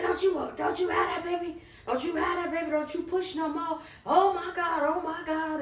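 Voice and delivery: high, frantic imitation of a mother begging her not to push; retold panicked pleading. Mother begging her daughter not to push out the baby, imitated high frantic pleading. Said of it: "she was just screaming".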